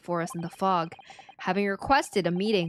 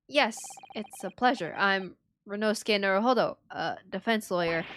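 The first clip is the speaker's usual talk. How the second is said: ordinary talk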